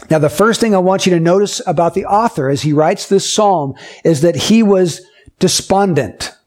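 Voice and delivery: ordinary talk, nothing marked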